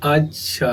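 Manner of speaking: "अच्छा" with a drawn-out final vowel; lengthened "अच्छा"; long vowel. Shown as a demonstration. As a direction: stretch the end of "अच्छा" long, as if objecting. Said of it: "हमने जैसे ही इसे दीर्घ कहा".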